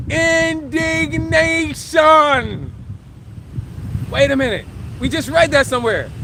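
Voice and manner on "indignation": a dramatic reveal, stretched to the limit; drawn-out payoff. Shown as a demonstration. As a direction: hold it, then shout it out slowly. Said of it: "Wait for it".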